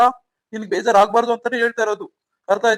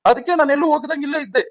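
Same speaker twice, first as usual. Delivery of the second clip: raised, high-pitched outburst; angry yelling; 4 semitones higher than usual. Acted. Husband who overheard his wife confronts her, angry yelling. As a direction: burst in accusing, pitch jumping up. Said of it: "ಹಿಂಗ್ ಕೂಗಾಡ್ತಾ ಇದ್ದೀರಾ".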